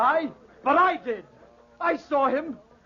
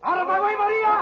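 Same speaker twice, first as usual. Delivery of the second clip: shouting escape; 8 semitones higher than usual. Acted. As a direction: bark him aside as he flees. Gamba fleeing, shoving past Maria.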